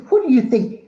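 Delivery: high, loud, outraged challenge; acted-out offended outburst; indignant retort. Teacher's indignant retort, retold.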